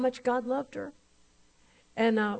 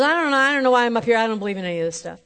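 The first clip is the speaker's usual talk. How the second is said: louder, rushed mock panic ending fast; freaked-out retelling; 9 dB louder, faster than usual. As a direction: imitate her flustered outburst. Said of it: "She's just all freaked out".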